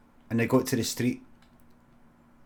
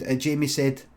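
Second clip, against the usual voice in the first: ordinary delivery, nothing marked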